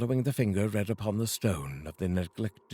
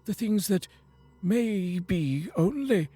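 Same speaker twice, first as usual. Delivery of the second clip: fearful Scrooge; 10 semitones higher than usual. Acted. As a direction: ask the silent ghost with dread. Scrooge questions the ghost.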